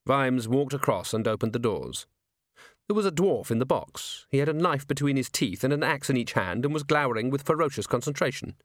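usual speaking voice

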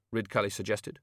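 ordinary delivery

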